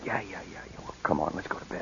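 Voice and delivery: soft whispered coaxing, fading to breath. Acted. Husband whispering to his wife beside the sleeping baby, whispered. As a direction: hush it so the baby won't wake.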